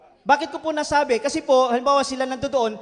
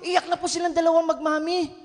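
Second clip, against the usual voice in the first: usual speaking voice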